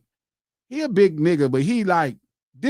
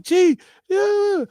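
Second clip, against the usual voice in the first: mom screaming; 11 semitones higher than usual. Retold or imitated.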